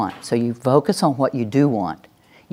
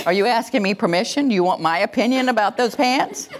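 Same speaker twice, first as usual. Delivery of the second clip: higher-pitched string of teasing questions; mock incredulous; 7 semitones higher than usual. Listener teasing someone who asks permission, mock incredulous.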